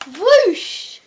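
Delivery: loud, high whoosh of a rocket. Acted. Child reader voicing the rocket launch, loud whoosh. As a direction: blast it off loudly.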